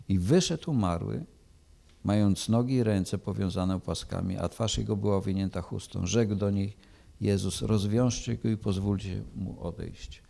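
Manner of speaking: measured, solemn Gospel reading; scripture reading. Acted. Preacher reading John to the church, solemn and measured. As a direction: read the Gospel to the congregation with gravity.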